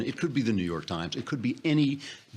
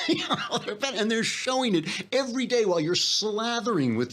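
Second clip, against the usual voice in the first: higher, laughing through the words; laughing scorn; 12 semitones higher than usual. Real, not acted. Mocking the leftists who hate these people, laughing through the words. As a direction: laugh at them while ranting. Laughs mid-rant at opponents.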